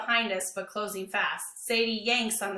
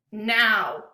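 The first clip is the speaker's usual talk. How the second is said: louder angry order; angry command; 7 dB louder than usual. A narrator's reading. Lewis commanding dog.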